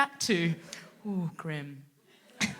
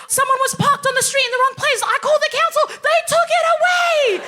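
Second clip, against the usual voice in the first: high, loud, building to a shout; thrilled dad; 7 dB louder than usual. Retold or imitated. Dad bragging to his daughter about the council, high and shouting. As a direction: crow with delight at the council.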